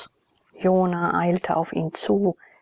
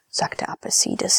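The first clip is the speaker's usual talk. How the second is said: ordinary delivery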